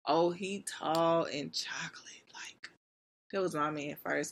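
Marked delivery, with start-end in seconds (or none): 0.1-2.7 whispered, slow and dreamy; swooning whisper. Real, not acted. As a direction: breathe it like a secret crush. Swooning over a crush.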